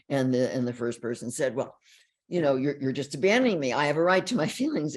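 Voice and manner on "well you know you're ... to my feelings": louder mock accusation, a rush at the end; accusing complaint. First person accusing the disengaged partner, louder mock blame. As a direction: play the hurt partner blaming.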